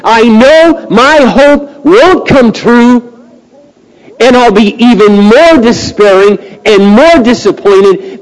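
high, loud, drawn-out pulpit cry; full-force preaching. Acted. Preacher voicing a prisoner afraid to hope, impassioned preaching. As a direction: preach it with long, ringing emphasis.

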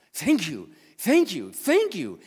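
high, loud, eager thank-yous; mock excited neighbor thanking the pastor; energetic thanks. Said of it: "very, very energetic".

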